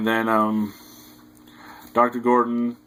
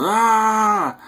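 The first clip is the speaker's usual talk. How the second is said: long high-pitched imitated yell; super yell; 12 semitones higher, 5 dB louder than usual. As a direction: let out a loud, sustained mock scream. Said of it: "super yell".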